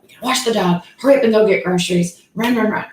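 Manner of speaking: mock frantic rush of orders; hurried pace. Mom rushing through dog washing and groceries, hurried pace. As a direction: imitate someone hustling everyone through chores. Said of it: "the pace was killing me".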